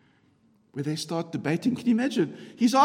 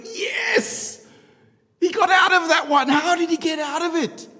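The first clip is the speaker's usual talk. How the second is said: much higher and louder, laughing delight; cheering crowd; 9 semitones higher, 5 dB louder than usual. Retold or imitated. Preacher voicing crowd delighted at Jesus's escape, excited cheer.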